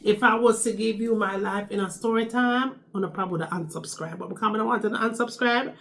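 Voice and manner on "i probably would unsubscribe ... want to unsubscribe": normal talking voice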